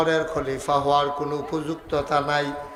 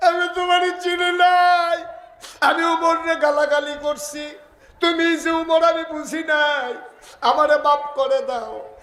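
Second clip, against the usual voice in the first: high, wailing preacher's plea; desperate plea; 13 semitones higher, 4 dB louder than usual. Acted. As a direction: cry out begging for forgiveness. Woman begs Umar for forgiveness.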